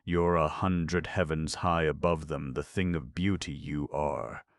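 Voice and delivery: ordinary delivery, nothing marked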